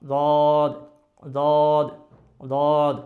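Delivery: "দদ" loud and level, from one edge of the tongue; one-sided Dad. Shown as a demonstration. Teacher showing the permitted alternative, "দদ" from one tongue edge. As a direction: hold "দদ" using just one side of the tongue. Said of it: "ওইভাবে যদি উচ্চারণ করি তাহলে কীভাবে".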